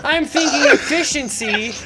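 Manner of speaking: high, loud laugh-cracked insistence; cracking up mid-argument; laughing rant. Real, not acted. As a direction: push through your own laughter. Laughing while defending his logic.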